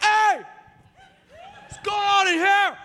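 loud, high, drawn-out holler; high-pitched shout. Retold or imitated. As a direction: shout it in comic alarm. He challenges the huge woman, startled.

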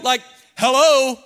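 loud, high incredulous hello; exasperated hello. Acted. Disciples calling out to Jesus, exasperated. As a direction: demand his attention, fed up. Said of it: "they look at Jesus and say".

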